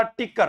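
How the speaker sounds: टिक्कर with a hard ṭ in place of k; Bagri-style टिक्कर for kikkar; Bagri mispronunciation. Shown as a demonstration. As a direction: say टिक्कर, starting on retroflex ṭ. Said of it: "क्या बोलता था हमारे बागड़ी में".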